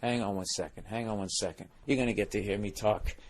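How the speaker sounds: real hushed aside while going to answer; low-volume, distracted interruption; off-mic aside. Real, not acted. Steps away for room service.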